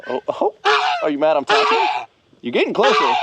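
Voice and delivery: squeaky high taunt at the goose; teasing. Retold or imitated. Owner teasing an angry goose in a high mock voice. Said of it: "he gets mad 'cause I'll go up and".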